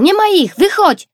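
high, loud, fast call. A narrator's reading. Kajtek calls Tosia out of hiding, excited shout. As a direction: shout the good news across the garden. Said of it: "zawołał".

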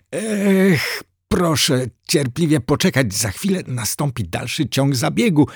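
put-on high, trembling nervous boy; nervous assistant stammering it out. A narrator's reading. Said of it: "odpowiedział drżącym głosem".

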